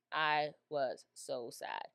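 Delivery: I was so sad drawn out slowly; slowed sad repeat. Shown as a demonstration. Telling viewers about the Queen's grief, slow and heavy.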